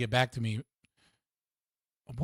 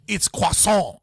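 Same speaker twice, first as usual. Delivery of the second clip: croissant said the French way, kwah-SAH; mock French correction, croissant said kwah-SAH; indignant French accent, croissant said kwah-SAH; 4 semitones higher, 5 dB louder, slower than usual. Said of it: "a Frenchie shows up and goes".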